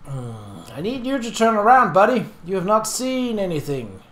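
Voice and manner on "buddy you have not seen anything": usual speaking voice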